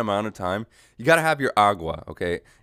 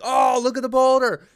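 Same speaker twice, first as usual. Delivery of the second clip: high, loud exclamation; mock alarm; 12 semitones higher, 5 dB louder than usual. Hiker spotting a falling boulder, mock-alarmed shout. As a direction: call out in hammed-up amazement.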